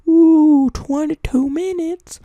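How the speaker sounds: very high, drawn-out squeaky voice; silly falsetto echo; mock squeal. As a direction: squeal it slowly in mock amazement. Mock squeaky repeat of himself.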